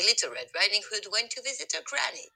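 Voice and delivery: ordinary delivery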